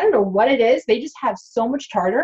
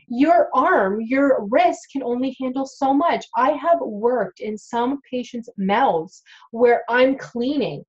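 ordinary talk

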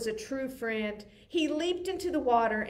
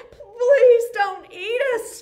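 much higher, louder, slow pleading; frightened cry; 12 semitones higher, 13 dB louder, slower than usual. Acted. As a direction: beg for your life in terror. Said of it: "cried the dogs".

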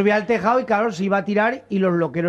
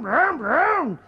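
brum agudo de motor con la boca; ruido de moto imitado; 7 semitones higher than usual. Retold or imitated. (imitando una moto, agudo)

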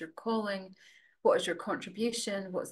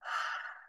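long exhale sighed out without voice; breathy sigh; 3 dB quieter than usual. Shown as a demonstration. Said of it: "sighing out the breath".

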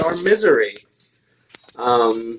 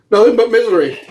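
high-pitched, half-amused lament; raised voice of comic suffering; laughing misery; 7 semitones higher, 3 dB louder, faster than usual. Real, not acted. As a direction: wail your misery, about to laugh. Miserable from chip, laughing after.

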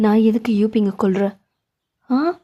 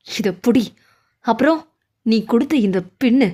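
ordinary talk, nothing marked

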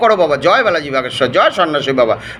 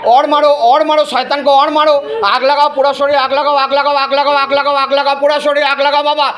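raised, rhythmic repeated commands; incantation; 5 semitones higher than usual. Real, not acted. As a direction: pound out the mantra-like orders. Exorcist's repeated ritual commands.

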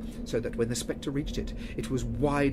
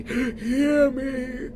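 a high, loud commanding call; ghostly cry; 13 semitones higher, 8 dB louder than usual. Acted. Marley's ghost cries out to the quaking Scrooge. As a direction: cry out to seize his attention. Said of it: "cried the ghost".

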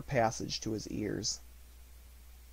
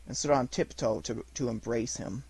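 usual speaking voice

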